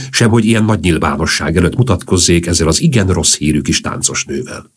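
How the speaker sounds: ordinary delivery, nothing marked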